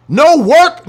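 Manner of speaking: high, loud and sharp; loud, high preacher cry; shouted rebuke. Real, not acted. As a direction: bark it out.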